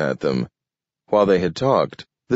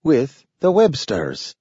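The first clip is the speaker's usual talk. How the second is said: high, slow talking dog; a slow, careful talking dog; 7 semitones higher, slower than usual. A narrator's reading. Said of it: "declared Nathaniel".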